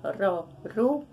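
ro and ru with a light tapped r; soft r syllables. Shown as a demonstration. Teacher models the weak r sound for children. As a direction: tap the r lightly in ro, ru. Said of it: "é porque é o som fraco".

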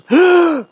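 sharp high gasp of shock; imitated gasp. Pastor imitating the church's gasp, sharp high gasp.

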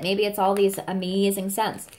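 ordinary delivery

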